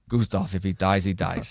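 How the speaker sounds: Gustave, if he dies, he dies in a low, hammed-up Russian accent; mock Russian accent. Shown as a demonstration. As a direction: do the Ivan Drago line in a mock Russian voice. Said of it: "how about this?".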